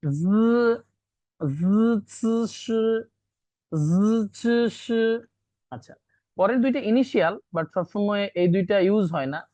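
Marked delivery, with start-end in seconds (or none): 0.0-4.8 জ, চ, শ as long buzzing, hissing syllables; tongue against teeth. Shown as a demonstration. Teacher showing three characters, sounds made against teeth. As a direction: press to the teeth and hold জ, চ, শ. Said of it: "জিহ্বা একদম দাঁতের সাথে লেগে".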